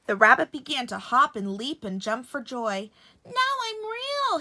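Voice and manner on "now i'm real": very high, slow jubilant voice; joyful laugh. A narrator's reading. Rabbit rejoicing at becoming real, very high. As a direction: sing it out in joy. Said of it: "he laughed".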